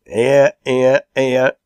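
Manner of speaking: loud, high repeated cheer; cheer of approval. A narrator's reading. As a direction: applaud the coming toast heartily. Meeting cheers the toast.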